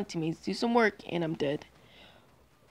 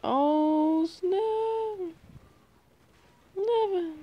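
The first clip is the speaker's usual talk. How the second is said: panicked shout; 11 semitones higher, 3 dB louder, much slower than usual. Real, not acted. Gamer panicking at near death.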